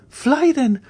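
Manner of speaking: high loud urging; urgent cry. A narrator's reading. Mademoiselle d'Armilly urging Andrea to flee, high cry. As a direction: cry out to him to run. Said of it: "cried Mademoiselle d'Armilly".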